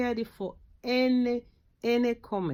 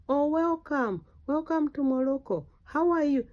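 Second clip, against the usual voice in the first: friendly Moroccan greeting; 6 semitones higher than usual. Retold or imitated. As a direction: greet a stranger brightly.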